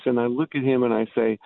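ordinary delivery

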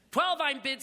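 high, loud auctioneer's call. A narrator's reading. Willie to the bidders, high loud auctioneer's call. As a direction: announce the bid to the whole tent.

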